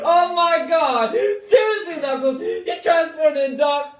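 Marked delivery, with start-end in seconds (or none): none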